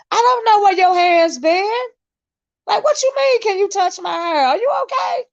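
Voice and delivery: high, incredulous squeal; mock disbelief. Streamer mocking people who ask to touch her hair, high incredulous voice.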